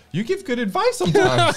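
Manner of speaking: high, playful teasing; hammed-up compliment said up high; mock grand praise. Teasing praise, laughter follows.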